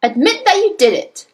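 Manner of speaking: high, forceful demand; roaring. A narrator's reading. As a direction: press angrily for a confession. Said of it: "roared the Trunchbull".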